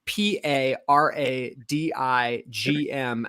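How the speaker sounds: slow letter-by-letter spelling. Shown as a demonstration. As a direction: spell each letter out slowly. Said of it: "first time I read the word".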